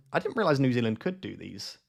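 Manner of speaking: ordinary talk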